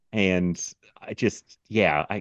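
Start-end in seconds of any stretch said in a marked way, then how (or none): none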